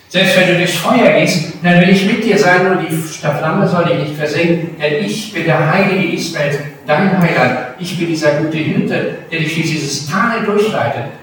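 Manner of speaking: loud, ringing declaration as God; proclaiming God's promise. Acted. Preacher voicing God's promise to the congregation, loud and ringing. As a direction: boom it out like a prophet.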